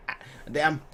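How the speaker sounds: usual speaking voice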